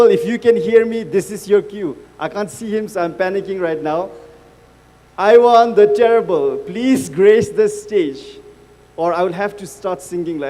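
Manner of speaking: ordinary talk, nothing marked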